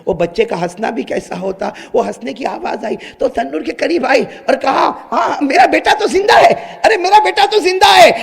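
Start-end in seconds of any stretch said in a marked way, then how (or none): 5.1-8.1 high, loud overjoyed shout; joyful cry. Acted. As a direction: shout with relief. Mother finds son alive. (خوشی سے چیختی ماں)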